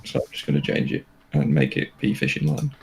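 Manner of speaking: ordinary delivery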